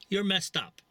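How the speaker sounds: ordinary delivery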